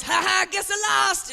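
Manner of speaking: hoog, traag en spottend; a slow, high, smug fake laugh from the stoned kid; mock gloating kid. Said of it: "then goes".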